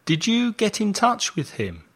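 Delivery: ordinary delivery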